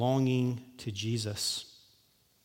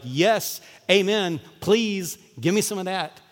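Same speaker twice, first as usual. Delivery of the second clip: louder, higher eager "amen" reply; eager congregation; 6 semitones higher, 5 dB louder, slower than usual. Pretend. Pastor voices his congregation eagerly wanting joy, louder and higher. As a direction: play the hungry crowd begging for more.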